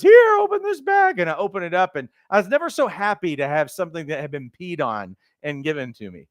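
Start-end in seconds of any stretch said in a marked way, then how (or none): 0.0-1.2 wife. Retold or imitated. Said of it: "And she goes".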